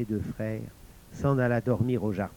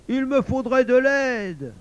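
high, drawn-out call for help; appel à l'aide; 11 semitones higher, 5 dB louder, slower than usual. Acted. (appel à l'aide, aigu et traînant)

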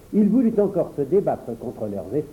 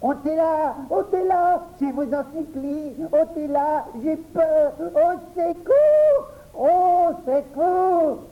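high, panicked begging, slowing into drawn-out cries; terrified pleading; 12 semitones higher, slower than usual. Acted. Auguste screams at the children to remove the frog. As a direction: beg for help in full terror. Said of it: "Se leva précipitamment et cria".

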